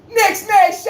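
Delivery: rally the fans with a yell; fan shout. Real, not acted. Host signing off to Knicks fans, loud high yell.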